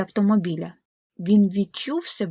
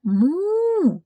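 a high, loud mock moo; higher cow moo; 9 semitones higher, 4 dB louder than usual. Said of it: "сказали корови".

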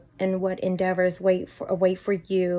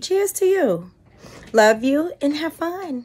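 excited toast; 8 semitones higher, 3 dB louder than usual. Real, not acted. Toasting the graduate.

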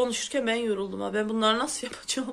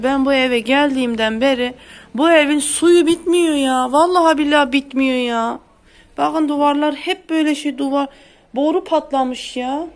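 louder exasperated complaint; fed up; 3 semitones higher, 10 dB louder than usual. Real, not acted. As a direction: vent about the endless leaks. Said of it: "sinir oluyorum". (sinirli, yüksek sesle şikâyet)

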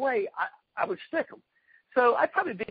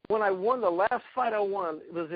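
ordinary talk, nothing marked

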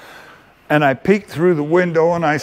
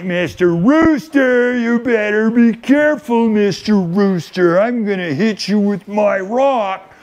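put-on little boy's threat; 4 semitones higher than usual. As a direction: puff up like an angry four-year-old. Four-year-old threatening the rooster.

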